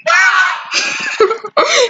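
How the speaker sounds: high shrieking laugh of panic; panicked laugh-scream. Real, not acted. Screams as the killer arrives.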